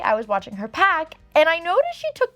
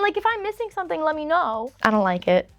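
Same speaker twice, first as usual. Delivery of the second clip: ordinary talk, nothing marked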